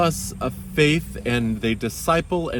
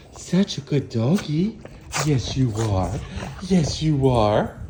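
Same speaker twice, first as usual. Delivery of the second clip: talking to a dog. Real, not acted. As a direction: coo over a good doggy. Talking baby talk to the dog.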